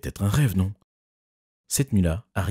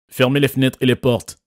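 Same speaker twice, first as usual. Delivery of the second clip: higher, louder shout; father yells an order behind him, higher and louder; 6 semitones higher, 6 dB louder than usual. A narrator's reading. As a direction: yell it back over the wind. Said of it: "a crié mon père".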